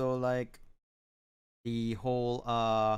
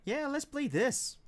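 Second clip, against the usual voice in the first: excited; 13 semitones higher than usual. Retold or imitated. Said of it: "I was like".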